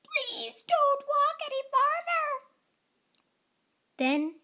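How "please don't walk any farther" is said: much higher, tiny pleading voice. Acted. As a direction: beg like a tiny creature underfoot. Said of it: "she heard a tiny voice".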